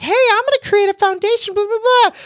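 mock the do-gooder who wants to start a foundation; high mocking voice, trailing off into blah blah; mocking eager newcomer. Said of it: "I know people wanna do something like".

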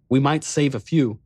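usual speaking voice

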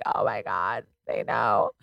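low, soft, amazed aside; hushed awe. Retold or imitated. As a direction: re-enact quiet disbelief at being recognised. Retold awe at being recognised.

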